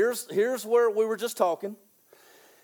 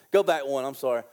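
ordinary delivery

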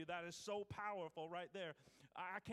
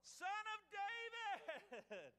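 long, drawn-out high call; crying out louder; 12 semitones higher, much slower than usual. Acted. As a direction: stretch it out as a desperate shout. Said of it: "cry out all the more".

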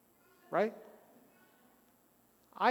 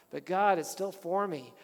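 usual speaking voice

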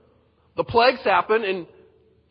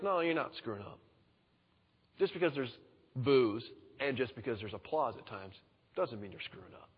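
gentle reassurance; 6 semitones lower, 9 dB quieter than usual. Acted. God reassuring Moses.

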